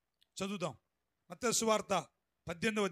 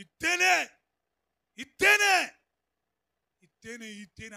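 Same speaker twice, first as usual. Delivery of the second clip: demanding; 2 semitones higher, 10 dB louder than usual. Retold or imitated. Creditor demanding his five rupees from the debtor, mock angry. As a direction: repeat it like a creditor who won't let go.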